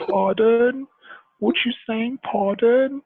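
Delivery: high, loud mock-puzzled questioning; mimicked incomprehension, rising at the end; baffled English locals. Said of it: "And they're like".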